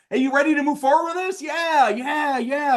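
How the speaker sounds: high, excited sales question and answer; pumped-up, high-pitched yeses; hammed-up eager close. Shown as a demonstration. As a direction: sell it with over-the-top eagerness.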